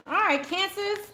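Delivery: usual speaking voice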